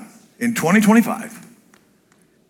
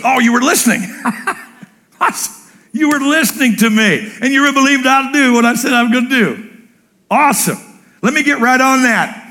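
high, excited voice of a pleased father; delighted God; 8 semitones higher than usual. Retold or imitated. God answering his child who remembered, delighted. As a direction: beam with joy that they listened. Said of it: "Because then he goes".

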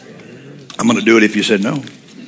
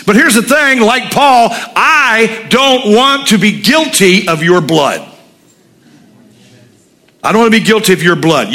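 much higher, louder fervent preaching; preaching at full force; 10 semitones higher, 3 dB louder than usual. Acted. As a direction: preach hard, warning the congregation.

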